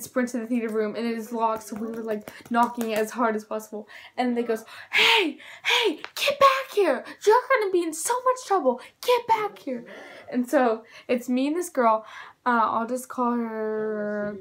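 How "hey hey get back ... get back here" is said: much higher, shrill-voiced chasing shout; yelling lady. Hall lady shouting after fleeing students, high angry yell. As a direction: holler after runaway kids like an angry hall monitor.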